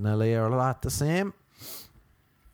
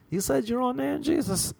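teasing; 13 semitones higher than usual. Acted. Laughing, teasing Jesus.

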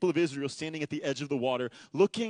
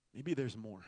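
wistful thought; 6 semitones lower, 10 dB quieter than usual. Retold or imitated. Longing inner thought.

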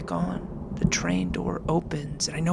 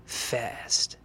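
plain reading voice

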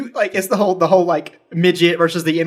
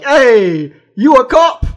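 loud, high Boston accent shout; 6 semitones higher, 7 dB louder, slower than usual. Shown as a demonstration. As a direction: call it out like a mouthy Bostonian. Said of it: "And then Boston's more like".

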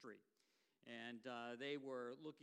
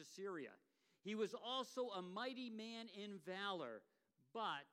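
raised, solemn Bible reading voice; scripture reading; 4 semitones higher, 4 dB louder than usual. Acted. Guest preacher reads Second Kings to the congregation, solemn and raised. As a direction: proclaim the verse to the congregation.

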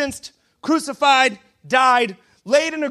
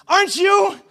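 high-pitched, pointed challenge; accusing bystander; 8 semitones higher, 3 dB louder than usual. Acted. Third person confronting Peter, mock accusation. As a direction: raise the pitch to single him out. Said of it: "Third person comes up".

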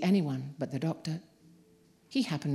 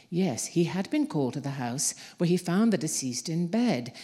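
ordinary delivery

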